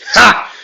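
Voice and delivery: sharp high burst of a laugh; defiant bark of laughter. Retold or imitated. Midoriya, stabbed, laughing at Shigaraki. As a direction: spit out one triumphant ha. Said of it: "Midoriya would say".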